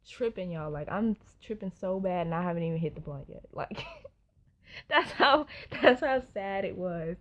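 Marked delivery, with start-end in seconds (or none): 4.3-7.2 laughing. Real, not acted. Laughing at own panic.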